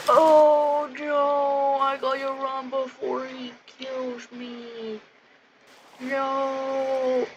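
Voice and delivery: high, slow mock panic; hammed-up scared cry; panicked victim. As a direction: whimper like a cornered character. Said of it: "Then he's like".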